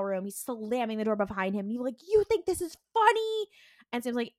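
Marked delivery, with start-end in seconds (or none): 2.1-3.5 high, louder accusing snap; angry accusation. Retold or imitated. Dean storming in on his brother about the tires, angry. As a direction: confront him hard. Dean confronts Sam over car.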